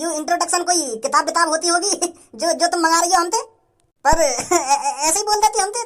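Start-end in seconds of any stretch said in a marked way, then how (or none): 4.0-5.8 sheepish laugh. Acted. Villager laughs at word.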